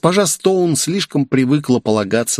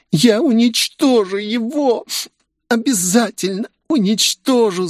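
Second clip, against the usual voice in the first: whiny tattling little sibling; 12 semitones higher than usual. A narrator's reading. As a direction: whine it like a spoiled child. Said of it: "захныкала Оливия". (капризное хныканье, высоко и медленно)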